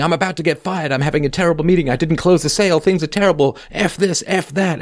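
high-pitched whiny husband voice, lightly voiced; mock stressed husband. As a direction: play a panicky husband griping over text. Mock husband texting complaints.

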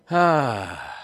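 big, loud sigh; long falling exhale of exasperation; exasperated sigh. Real, not acted. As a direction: let out a heavy, weary sigh. Exasperated sigh before rant.

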